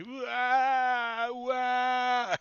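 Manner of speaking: high, loud wailing cries; panicked wailing. Acted. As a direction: wail like you're dangling helplessly.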